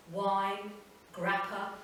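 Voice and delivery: usual speaking voice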